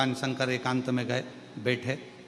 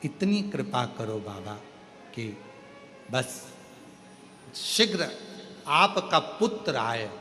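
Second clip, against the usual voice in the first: soft, lower, devotional pleading; humble prayerful plea; 6 semitones lower, 5 dB quieter than usual. Acted. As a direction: beg Shiva gently for release. Demon's devotional plea to Shiva.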